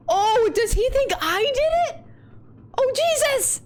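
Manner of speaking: very high, flustered exclamations; panicked realization. Acted. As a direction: squeal it in dawning alarm. Realizes the character is accused.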